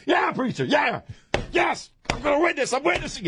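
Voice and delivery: high, fervent call-back to the preacher; hammed-up revival yelps; fired-up congregant. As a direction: cheer the preacher on at fever pitch. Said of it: "and I'm like".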